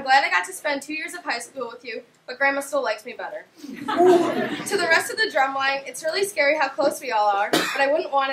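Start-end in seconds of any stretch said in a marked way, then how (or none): 3.6-4.6 laughing. Real, not acted. Laughs after grandma joke.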